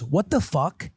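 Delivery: lightly voiced kid, "What the fuck" said in full; mimicking child. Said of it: "She just goes".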